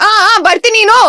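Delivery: high, loud put-on retort; mock shouting. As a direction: shout it out on your friends' behalf. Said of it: "shout at him in Kannada".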